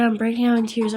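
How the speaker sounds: ordinary talk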